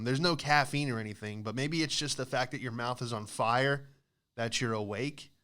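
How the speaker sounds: ordinary talk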